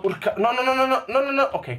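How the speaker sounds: rapid rising panicked no no no; blurting out in alarm. Real, not acted. Panics on a slippery jump. (no no no in preda al panico)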